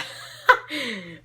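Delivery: a real high laugh breaking out; burst of laughter. Real, not acted. Cracking up at the fanfic she reads. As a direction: laugh out loud at the line.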